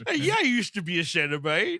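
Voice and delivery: high, hammed-up slurry oaf; goofy put-on voice, dragging the words; Jim Belushi. Revived Butterball learns he's in Vegas, goofy and hungry. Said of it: "Jim Belushi".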